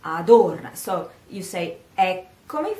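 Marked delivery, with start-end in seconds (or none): 0.0-0.8 Adorna, O stressed, louder with falling close. Shown as a demonstration. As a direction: punch the O in Adorna. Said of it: "Small O".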